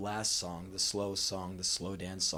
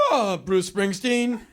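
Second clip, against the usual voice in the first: higher, slow, loud feigned enthusiasm; trying to impress; 7 semitones higher, 5 dB louder, slower than usual. Acted. Boy trying to be down with her, feigned enthusiasm. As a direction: act like a knowing fan. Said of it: "trying to, like, be down with her".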